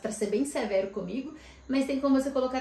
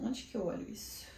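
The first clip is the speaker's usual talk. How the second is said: whispered to herself while searching; whispered aside; 9 dB quieter than usual. Real, not acted. Vlogger hunting for her score on the app, whispering to herself. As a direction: drop to a private whisper, just for yourself.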